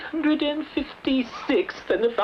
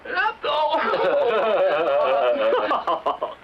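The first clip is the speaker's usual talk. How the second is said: hearty laugh. Acted. King bursts out laughing.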